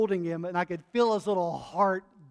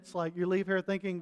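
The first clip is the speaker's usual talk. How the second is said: ordinary delivery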